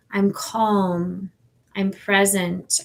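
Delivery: normal talking voice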